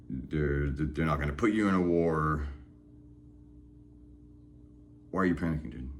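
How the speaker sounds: low, soft reassuring voice; calm self-talk. Acted. The speaker calming himself out of panic, low and soft. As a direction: talk yourself down gently. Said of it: "reality check for myself".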